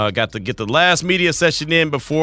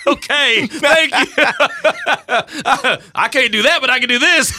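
laughing; 11 semitones higher than usual. Real, not acted. Laughing at co-host answer.

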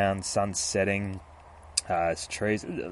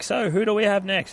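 much higher and louder, bright vendor call; cheery ice cream man; 11 semitones higher, 5 dB louder than usual. Retold or imitated. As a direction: call out for the next kid. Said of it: "he's like".

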